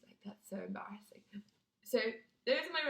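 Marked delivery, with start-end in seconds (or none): 0.0-1.2 breathy embarrassed whisper. Real, not acted. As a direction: whisper it like a confession. Aside about embarrassment.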